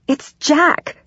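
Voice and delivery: high-pitched frightened cry; high, contained narrated shout; panicked shout. A narrator's reading. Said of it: "all I can do is shout".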